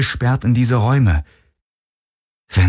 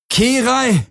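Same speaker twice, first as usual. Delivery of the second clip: much higher desperate scream; 14 semitones higher than usual. A narrator's reading. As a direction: scream her name, hammering the door. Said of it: "schrie er".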